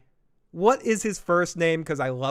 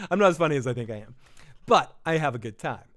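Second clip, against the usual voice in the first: ordinary talk, nothing marked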